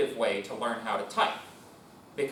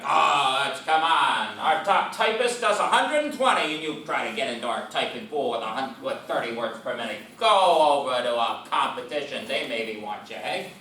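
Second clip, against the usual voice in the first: loud, scoffing fifties boss; 6 dB louder than usual. Retold or imitated. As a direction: scoff loudly at the applicant.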